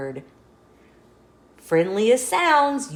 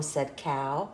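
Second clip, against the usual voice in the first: ordinary delivery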